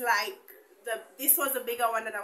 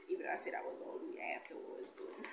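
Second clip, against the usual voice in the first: fast, much softer whisper to herself; whispered aside; 10 dB quieter, faster than usual. Real, not acted. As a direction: rattle it off under your breath. Muttered aside while tidying.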